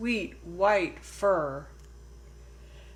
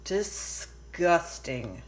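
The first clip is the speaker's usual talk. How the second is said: slow, disgusted drawl; a slow, sneering disgusted child; slower than usual. Acted. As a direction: spit out the word in disgust. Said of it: "said Lily".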